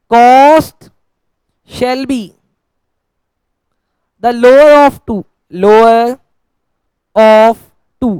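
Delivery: emphatic, drawn-out words with pauses between; slow dictation. Real, not acted. Teacher dictates the accounting rule to students, slow emphatic dictation. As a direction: spell out the rule piece by piece, slowly.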